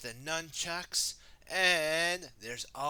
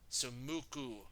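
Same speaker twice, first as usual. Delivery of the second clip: ordinary delivery, nothing marked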